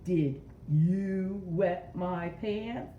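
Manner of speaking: slow, YOU leaned on, rising at the end; stress on YOU. Shown as a demonstration. Showing kids the bright word, stress on you. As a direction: make you the word that stands out. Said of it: "I said it a little differently".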